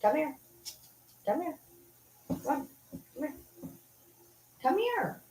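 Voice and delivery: high, soft calls to a pet; coaxing pet call. Real, not acted. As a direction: coax the dog over in a high, quiet voice.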